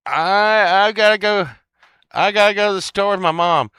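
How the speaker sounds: put-on high, loud childish complaint; mock whiny kid. Kid leaving friends to shop with mom, mock whine. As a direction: whine it loudly like a reluctant kid.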